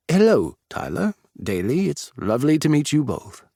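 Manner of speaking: prim, proper British hello; British greeting. Shown as a demonstration. Monty greeting Tyler on request in British, lightly proper. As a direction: lay the British on a little. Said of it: "What in good heavens do I say in British?".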